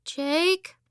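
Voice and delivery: put-on high small girl's voice calling out, rising; a sleepy little sister looking for her brother. A narrator's reading. Little sister calling from the porch for her brother, high and small.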